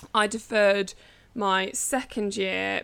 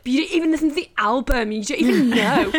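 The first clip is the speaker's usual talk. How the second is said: mock teen outrage; 4 semitones higher, 6 dB louder than usual. Retold teen row with sister.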